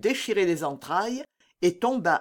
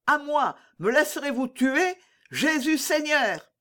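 high, pleading call for help; desperate cry; 8 semitones higher than usual. A narrator's reading. D'Artagnan feigning mortal danger before the soldiers, crying out desperately. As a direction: cry out high, drawing out the last words. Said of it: "avait-il poussé ce cri".

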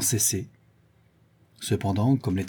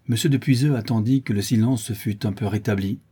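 plain reading voice

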